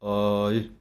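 ਆਏ with a full open ਐੜਾ ਕੰਨਾ vowel; careful pronunciation. Shown as a demonstration. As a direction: stretch the vowel in ਆਏ. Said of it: "ਉਸੇ ਢੰਗ ਨਾਲ ਹੈ".

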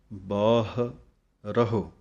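ਬਾਹਰਹੁ slowly in two parts, baah, ra-hu; broken-down pronunciation. Shown as a demonstration. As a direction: pause after baah in ਬਾਹਰਹੁ. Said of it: "ਇਸ ਤਰ੍ਹਾਂ ਬੋਲਾਂਗੇ". (ਬਾਹਰਹੁ ਨੂੰ ਬਾਹ-ਰਹੁ ਤੋੜ ਕੇ)

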